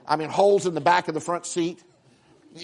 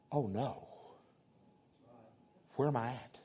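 quiet dread; 5 semitones lower, 15 dB quieter than usual. Acted. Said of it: "and he went".

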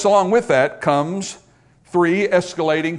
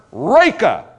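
forceful mock-angry name-calling; acted angry insult; 3 semitones higher, 6 dB louder than usual. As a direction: hurl it loudly as an angry insult. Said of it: "now acts on his anger".

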